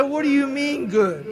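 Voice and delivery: mock outraged "What do you mean good?"; indignant retort. Indignant reply to sponsor.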